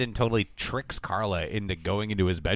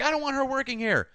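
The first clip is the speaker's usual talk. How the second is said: much higher whiny mock yell; 12 semitones higher than usual. Retelling the show, mocking Brandon yelling at mom. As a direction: play a petulant teen yelling. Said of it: "he yells at his mom".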